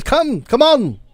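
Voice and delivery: high, insistent come-on, come-on; high-pitched eager beckoning; eager old traveler beckoning. Acted. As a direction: wave them over like an excited old man. Volo beckoning at Granite.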